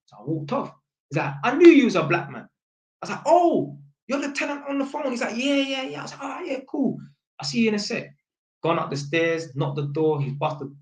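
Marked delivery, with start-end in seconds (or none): 1.4-2.4 raised, louder retold exclamation; acting out the tenant's pleased outburst; delighted recognition. 3.3-8.1 high-pitched retold realisation; friendly high-pitched catching on; surprised recognition. Acted exchange of surprised recognition.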